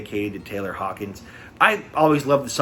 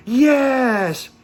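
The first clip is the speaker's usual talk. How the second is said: loud, high, drawn-out mock cheer; imitated crowd shout; 11 semitones higher, 5 dB louder than usual. Audience confirms its answer to the singer, imitated crowd shout. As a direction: holler it back as one big audience. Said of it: "And the audience went".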